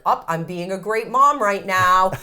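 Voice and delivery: high, loud, sing-song mock proud mom; hammed-up self-praise of a perfect gentle parent; smug great mom. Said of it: "sometimes I can be like".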